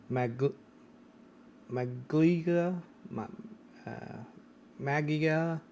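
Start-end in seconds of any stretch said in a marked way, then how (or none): 1.7-2.8 MAGLIGA sounded out slowly, a little high, as an uncertain guess; unsure pronunciation. Real, not acted. Host stumbling over the name of the Linux he installs, unsure pronunciation of "Magliga". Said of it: "however you pronounce it". 4.8-5.6 MAGIA tried out tentatively, pitched a little high; unsure pronunciation. Real, not acted. Host guessing at the name of the Linux he installs, unsure pronunciation of "Magia". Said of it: "however you pronounce it".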